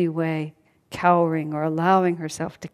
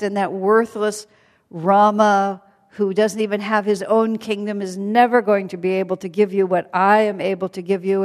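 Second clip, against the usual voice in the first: boastful demon king; 3 semitones higher, 8 dB louder than usual. Acted. Ravana boasting to captive Sita.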